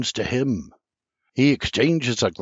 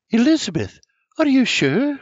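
high, worried mother; mother's voice; 13 semitones higher, 4 dB louder than usual. A narrator's reading. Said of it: "Me ma asks".

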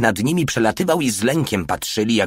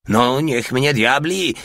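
slow, higher astonished cry; amazed exclamation; 5 semitones higher, slower than usual. A narrator's reading. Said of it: "krzyknął zdumiony Porta". (zdumiony, przeciągły okrzyk)

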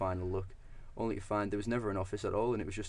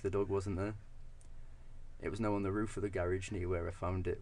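normal talking voice

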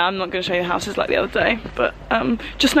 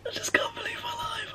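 very high, soft, breathy squeak; overwhelmed tearful squeak; 9 dB quieter than usual. Real, not acted. Vlogger after her launch, tearful disbelief. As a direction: squeeze it out through tears.

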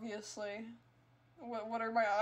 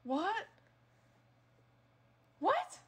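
each what higher and louder, ending in a squeak; building disbelief; 4 semitones higher, 4 dB louder, much slower than usual. Real, not acted. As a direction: disbelief climbing to a shriek. Rising disbelief.

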